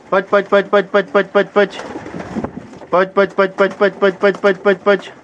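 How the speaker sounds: high, loud repeated call; calling the birds. Real, not acted. Breeder calling young pigeons to come, with high loud rhythmic calls. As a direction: keep calling them in, pitched high and loud.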